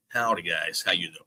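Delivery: low Southern howdy; mock American accent. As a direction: put on a folksy American greeting, pitched low. Trying a put-on American drawl.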